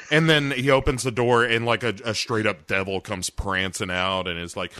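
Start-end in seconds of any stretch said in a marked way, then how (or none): none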